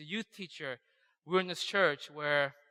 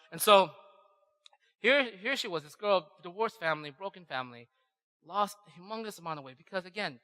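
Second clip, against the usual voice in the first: ordinary talk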